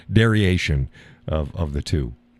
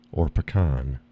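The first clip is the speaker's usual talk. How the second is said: usual speaking voice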